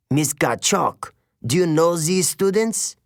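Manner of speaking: put-on lightly French-accented, doubtful question; a suspicious teacher checking with a colleague; a hint of French. A narrator's reading.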